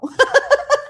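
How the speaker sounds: high, delighted laugh; real burst of laughter. Real, not acted. Host laughing at the end of her own story, high and delighted. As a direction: laugh out at the punchline.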